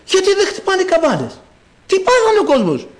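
high-pitched baffled questioning; astonished. Acted. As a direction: play a grandmother who cannot believe it. Acted grandmother, astonished at the window. (έκπληκτη, ψηλή φωνή γιαγιάς)

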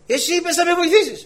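high, loud mock complaint; high indignant retelling; excited retold protest. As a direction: squeal the protest like a cheated schoolboy.

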